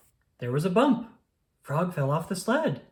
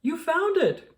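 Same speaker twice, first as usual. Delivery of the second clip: much higher, louder burst of joy; excited cry; 14 semitones higher, 5 dB louder than usual. A narrator's reading. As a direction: cry out in delight. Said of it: "cried Toad".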